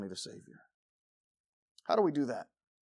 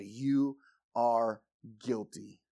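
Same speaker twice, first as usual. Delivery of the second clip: very slow, lower, word-by-word pronouncement; grave, spaced-out accusation; solemn verdict; 5 semitones lower, much slower than usual. Shown as a demonstration. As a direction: weigh each word like a judge. Models a solemn verdict to sinners.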